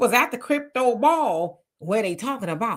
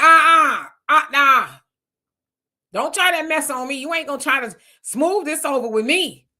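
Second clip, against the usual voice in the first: loud and higher at first, flat refusal; indignant refusal; 6 semitones higher, 5 dB louder than usual. Retold or imitated. Pulled-over brother refuses the cops' smooth talk, indignant. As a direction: shut the cops' excuse down hard. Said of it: "And the brother was like".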